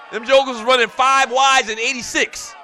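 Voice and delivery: high, loud burst of disbelief; incredulous shout. Real, not acted. Host marveling at rival team's offense, incredulous shout. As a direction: holler it in amazement, stretching the end.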